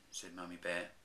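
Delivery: ordinary delivery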